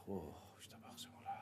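breathy hushed whisper, almost silent; whispered aside. Acted. Rabbi whispering an aside to the audience about praise. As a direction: let it slip out under the breath.

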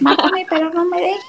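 high squawky parrot imitation.

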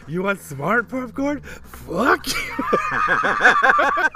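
high, loud, drawn-out imitation of an angry husband; hammed-up outburst cracking into laughter; mock rage at snacks. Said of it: "he looks at the chips, he's like".

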